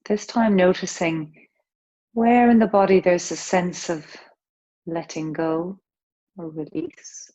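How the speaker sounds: calm, unhurried breath-awareness guidance; yoga teacher easing the class into breathing; guided meditation. Acted. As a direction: gently guide their attention through each breath. Leads a breath awareness practice.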